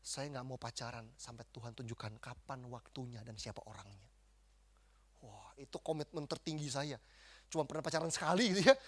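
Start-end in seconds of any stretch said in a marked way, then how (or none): 0.0-4.1 very soft, low prayerful promise; quiet, lowered voice of earnest prayer; solemn vow. Retold or imitated. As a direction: reenact a hushed commitment to God. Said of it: "ini komitmen yang kedua".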